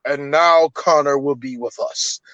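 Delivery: deep, lofty voice-over impression; low, solemn movie-god voice; James Earl Jones. Recreating the voice heard in the Highlander quickening, deep impression. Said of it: "James Earl Jones go".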